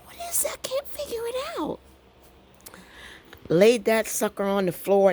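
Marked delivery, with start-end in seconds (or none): 0.1-1.8 quick, tiny squeak; very high, softer baffled whine; high-pitched voice. Retold or imitated. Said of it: "I got my high-pitched voice going on".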